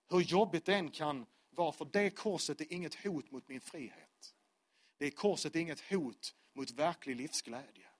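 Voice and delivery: ordinary talk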